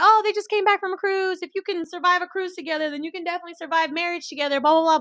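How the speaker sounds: high sing-song announcer voice; lightly mocking high announcement. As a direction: sweetly mimic the cabin crew. Mimicking the flight announcement.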